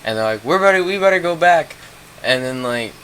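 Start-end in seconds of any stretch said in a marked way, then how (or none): 0.4-1.8 high, mock-anxious plea; worried urging. Scared friends wanting to turn back, mock worried urging. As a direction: push it out in a higher, fretful voice.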